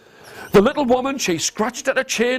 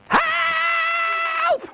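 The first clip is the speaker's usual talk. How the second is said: high, shrill cry for help from far off; shouted help. Acted. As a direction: call out for help as if stuck in the mud. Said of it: "a voice shouted out".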